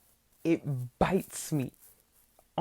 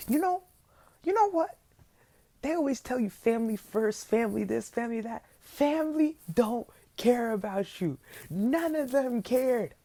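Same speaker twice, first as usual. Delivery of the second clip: indignant rant; 7 semitones higher, 4 dB louder than usual. Retold or imitated. Ranting at family for laughing.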